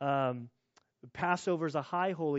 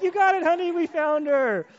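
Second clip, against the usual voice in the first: much higher, louder overjoyed praise; relieved excitement; 14 dB louder than usual. Retold or imitated. Parents finding their daughter, overjoyed and much louder. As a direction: burst out with relief. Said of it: "And we're like".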